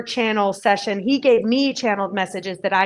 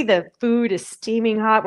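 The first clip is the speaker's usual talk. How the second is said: ordinary talk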